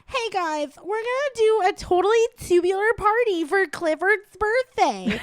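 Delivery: put-on high, bubbly Valley Girl accent. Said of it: "a Valley Girl accent every time they voiced the".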